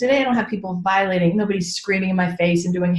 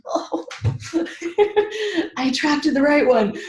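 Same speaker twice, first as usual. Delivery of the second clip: laughing delight; 7 semitones higher than usual. Retold or imitated. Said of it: "I'm like".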